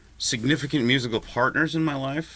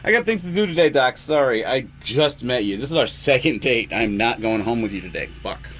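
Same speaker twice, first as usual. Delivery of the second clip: mock retort; 6 dB louder than usual. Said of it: "I was like".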